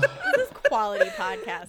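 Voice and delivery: laughing. Real, not acted. Says it while laughing.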